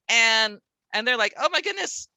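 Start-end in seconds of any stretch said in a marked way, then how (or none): 1.4-2.1 higher-pitched overwhelmed student; mock exasperation. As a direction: lightly act out a kid who's had enough. Acting overwhelmed struggling kids' reaction.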